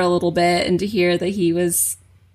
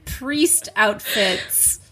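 higher, very slow disbelief; incredulous; 7 semitones higher, slower than usual. Real, not acted. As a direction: stretch it out in disbelief. Incredulous recap.

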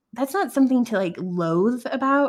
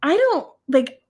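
high-pitched protesting excuse; mock defensive; 5 semitones higher, 4 dB louder than usual. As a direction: push back as if wrongly blamed, pitched up.

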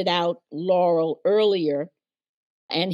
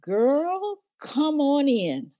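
higher, a light imitation of a welcoming older lady; 6 semitones higher, slower than usual. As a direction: invite her in, lightly voiced. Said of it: "She said".

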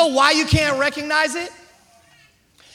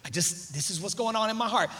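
soft, low, halting self-control; keeping it together; 5 semitones lower, 8 dB quieter than usual. Acted. Woman caught dabbing tears, quietly keeping it together. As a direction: insist quietly that you're fine. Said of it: "you keeping it together".

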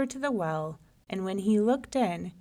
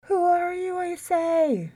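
high, sustained echoing call; echo from a well; 11 semitones higher, 5 dB louder, slower than usual. A narrator's reading. Well repeats the lion's demand, as an echo. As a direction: send it back like a distant echo. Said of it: "the voice came back up".

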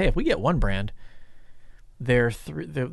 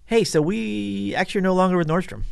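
higher, breezy imitated announcement; mock announcement; 8 semitones higher, 3 dB louder than usual. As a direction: lightly voice a buyer dropping the news. Buyers announce leaving Nordstrom.

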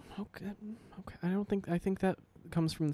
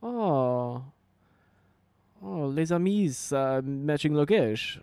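mock French; much slower than usual. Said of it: "they're gonna be like".

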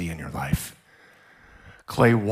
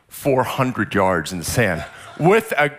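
ordinary talk, nothing marked

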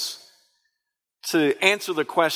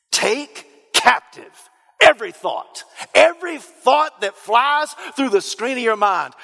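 emphatic preaching; 6 semitones higher than usual. Acted.

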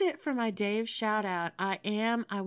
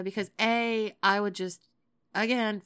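normal talking voice

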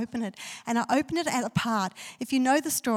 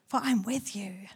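slow hushed whisper; God's reassurance spoken as a slow whisper; whispered promise; 3 semitones lower, 5 dB quieter than usual. Acted. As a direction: breathe it as a gentle secret. God's comforting word quoted softly.

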